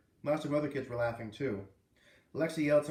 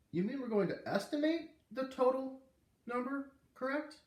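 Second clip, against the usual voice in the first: put-on high, earnest kid voice; eager student; 11 semitones higher than usual. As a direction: ask like a keen pupil.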